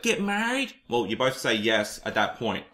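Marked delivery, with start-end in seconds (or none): 0.0-0.7 high question. Retold or imitated. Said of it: "she'd be like".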